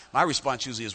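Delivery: ordinary delivery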